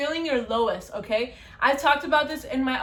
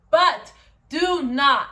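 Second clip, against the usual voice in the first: slow, loud "do not" with weight on each word; firm warning; 5 dB louder, much slower than usual. Real, not acted. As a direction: lean hard into "do not" to warn them. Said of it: "I repeat, you guys".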